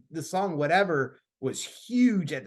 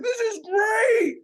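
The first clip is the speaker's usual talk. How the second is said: mock yell; 4 dB louder, much slower than usual. Thumb popped back in, mosh pit.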